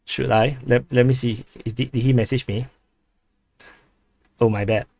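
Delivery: ordinary talk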